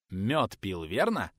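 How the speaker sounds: normal talking voice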